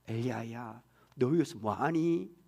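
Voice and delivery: soft, whispered and slow; whispered tender call. Shown as a demonstration. God gently asking Elijah, in a tender whisper. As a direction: breathe the question gently to him. God's gentle question repeated.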